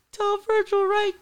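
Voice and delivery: very high squeaky rapper voice. Retold or imitated. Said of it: "that real squeaky".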